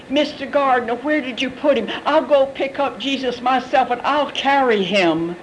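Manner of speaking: high-pitched, urgent appeal; pleading Mary. Acted. Mary pleading with the gardener, acted.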